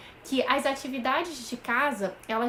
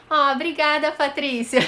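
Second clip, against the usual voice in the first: delighted thanks; 3 semitones higher, 7 dB louder than usual. Real, not acted. Thanking a viewer who praised her.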